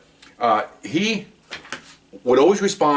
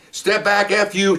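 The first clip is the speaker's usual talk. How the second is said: sharp command; 9 semitones higher, slower than usual. Retold or imitated. Supervisor confronts screaming mother.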